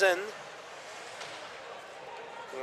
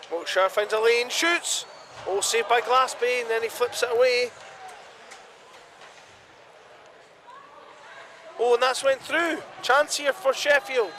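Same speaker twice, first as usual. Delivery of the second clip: excited play-by-play; 6 semitones higher than usual. Real, not acted. Live attack, save and rebound.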